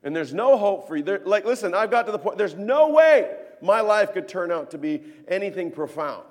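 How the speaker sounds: loud, high acted protest; impassioned preaching. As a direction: preach the hopelessness with force. Preacher at full force.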